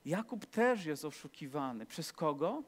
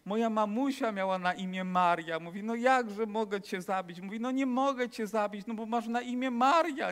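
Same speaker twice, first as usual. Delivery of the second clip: higher and louder, emotional burglar; moved exclamation; 5 semitones higher, 4 dB louder than usual. Retold or imitated. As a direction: burst out as if touched to the heart. Burglar moved, cannot kill her.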